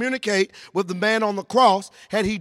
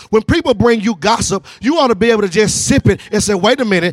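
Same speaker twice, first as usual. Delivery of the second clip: driving, rousing sermon delivery; full-force preaching; 6 dB louder than usual. Real, not acted. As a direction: preach it hard and urge them to respond.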